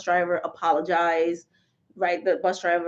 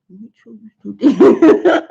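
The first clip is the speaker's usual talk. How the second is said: mimics mom's warning through laughter, louder; laughing mom imitation; 13 dB louder than usual. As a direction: daughter teasing mom's stern line, laughing. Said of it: "She goes".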